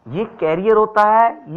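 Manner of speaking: teacher insisting on the carrier pronunciation, loud and slow; emphatic correction. Shown as a demonstration. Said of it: "गलत बोलते हैं।".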